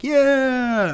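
long, high-pitched approving crowd imitation; mock crowd cheer. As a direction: draw it out high like an audience cheering along. Acting out a crowd cheering along.

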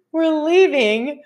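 real laughter stretching the words, high-pitched; high, drawn-out laughing voice. Real, not acted. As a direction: barely get it out through giggles. Cracking up over embarrassing story.

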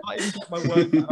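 ordinary talk

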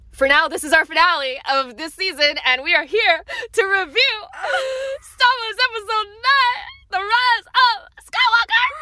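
fighting back tears to announce the review; emotional crying. Real, not acted. Host chokes up announcing the Star Wars review, voice cracking. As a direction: voice breaking high as she chokes up. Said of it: "Oh my God, I can't breathe".

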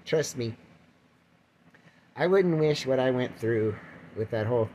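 normal talking voice

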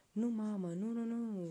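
put-on a boy howling for his mother; shouting no in despair; child's howl. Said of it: "urlă copilul".

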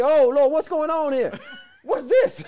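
high, loud panicked shout; men jolted awake in fright; startled disciples. Retold or imitated. Disciples startled by figure on water.

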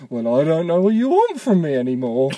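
imitating a Mitchell and Webb skit line; sketch-show impression. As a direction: quote the comedy sketch in a put-on voice. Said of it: "that old skit in that Mitchell and Webb".

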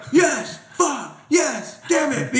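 high, flip-flopping cheer and curse; mock fan outburst. Acting out watching the game.

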